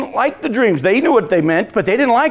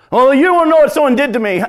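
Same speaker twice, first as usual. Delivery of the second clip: indignant objection; 7 semitones higher, 5 dB louder than usual. Acted. Voicing a hurt objector.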